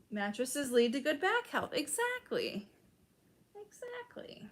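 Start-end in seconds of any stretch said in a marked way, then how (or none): 3.6-4.5 breathy stage whisper, much higher and far softer. Real, not acted. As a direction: whisper it back like a secret echo. Agreeing, then repeats whispered.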